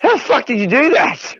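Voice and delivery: high, incredulous question; marvelling in disbelief; amazed question. Retold or imitated. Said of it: "I'm just like".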